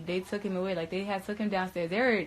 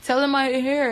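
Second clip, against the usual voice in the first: higher, louder begging; pleading friend; 6 semitones higher, 9 dB louder than usual. Retold or imitated. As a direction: insist desperately. Repeated pleading quote.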